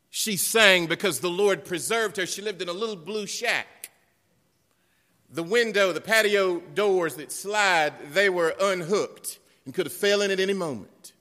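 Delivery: loud, high preaching, slowing at times; full-force preaching. Acted. Preacher tells the church how the Lord kept her house, loud preaching. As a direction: preach it out over the congregation.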